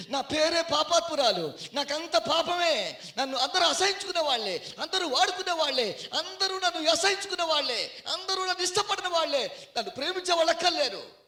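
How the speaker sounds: high, loud impassioned lament; acted anguished confession at full force; weeping woman's lament. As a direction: cry out as the shamed sinner. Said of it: "ఈ అమ్మ ఏడుస్తూ ఉంటే".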